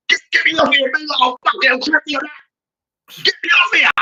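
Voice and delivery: Turkish player impression. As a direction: beg the bench to take you off. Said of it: "the Turkish man was like this".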